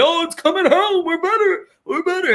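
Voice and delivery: high, taunting singsong of boastful fans; mocking England fans. Host mocks England fans before every tournament, high taunting voice. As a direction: lampoon the cocky chant. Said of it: "every fucking tournament".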